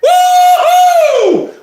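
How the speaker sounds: high, loud cheering whoop; excited whoop. Real, not acted. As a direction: whoop with joy. Cheering after the chant.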